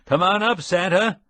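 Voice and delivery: high, loud calls from above; cheering crowd call; shouting. A narrator's reading. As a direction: shout up to Santa on the street. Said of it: "officials shouting".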